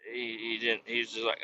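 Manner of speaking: normal talking voice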